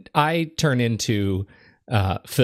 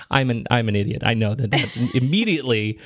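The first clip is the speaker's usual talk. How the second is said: ordinary delivery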